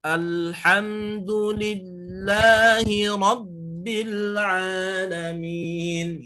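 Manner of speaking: slow chant pressing the doubled consonant in alhamdulillahi; slow recitation with the tasydid pressed. Shown as a demonstration. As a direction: lean on the tasydid as you chant. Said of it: "tasydid itu harus memang menekan".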